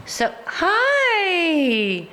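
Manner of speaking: high, bright greeting to the dog; excited hi. Real, not acted. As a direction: greet her like a delighted owner.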